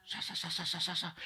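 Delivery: soft repeated "sa" flicker; quiet, rhythmic whooshing syllables; imitated film reel. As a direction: mimic scenes flashing past. Imitates life film flashing by.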